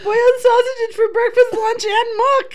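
can barely breathe for laughing; laugh-crying while reading. Real, not acted. Finishing the Mad Libs reading while crying with laughter.

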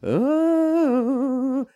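lilting high Oh; sing-songy. Shown as a demonstration. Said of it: "a sing-songy way".

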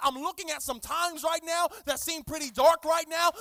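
loud, rising prayer cry; fervent prayer. Acted. Pastor modelling prayer for the church, fervent. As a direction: call out to the Spirit.

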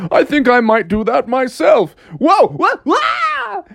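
high, loud boast tipping into wild whoas; cartoon dad losing control; acted excited dad. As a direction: climb to a yelped "whoa". Dad tries the grind, falls.

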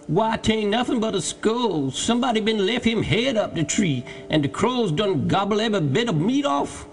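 high-pitched lilting dialect voice; Jupiter, marvelling servant. A narrator's reading. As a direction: report an odd find down to your master. Jupiter describes the skull.

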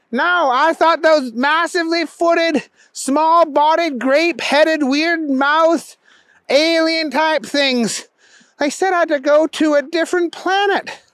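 high-pitched earnest protest; insistent Booger. A narrator's reading. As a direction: insist on your story in a high voice.

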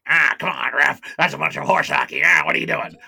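loud heckling of the ref; mock-furious sideline shout; mad dad imitation. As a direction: re-enact an angry parent yelling at officials. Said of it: "he got really mad at the refs".

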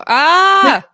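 loud, high playful scream; high-pitched joke scream; mock scream. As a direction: shriek in pretend alarm. Mock alarm at the body part.

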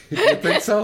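high laughing question. Real, not acted. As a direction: say it through a laugh. Laughing host reply.

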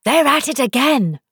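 loud storybook messenger's shout. Acted. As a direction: shout the news at the top of your voice. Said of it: "shouted at the top of his voice".

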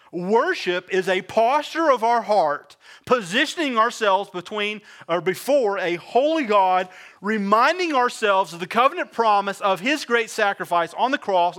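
full-force sermon climax; preaching at full force. Acted. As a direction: drive it home like a revival preacher.

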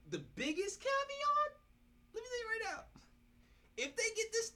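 high-pitched, building to a loud hype call; excited hype. Real, not acted. Hyped prediction about AB joining.

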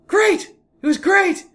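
put-on high, eager boy's voice; boy bragging to his dad at dinner; excited kid. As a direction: chirp it like a proud fourth grader.

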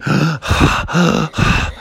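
out-of-breath gasp; panting gasp. Acted. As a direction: gasp after the climb. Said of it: "they were panting".

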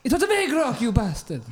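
high-pitched mock outrage; indignant outburst. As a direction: hurl the insult in comic indignation. Detective snaps at a doubter.

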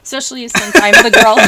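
loud, high burst through real laughter; laughing too hard to speak; helpless laughing. Real, not acted. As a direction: force the words out while cracking up. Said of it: "now I can't stop laughing".

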